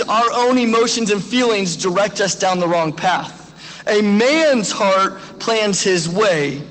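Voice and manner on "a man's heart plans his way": slow, weighty repeat of the verse; slow emphasis. Shown as a demonstration. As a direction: let each word land slowly. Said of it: "I wanna be really clear on this".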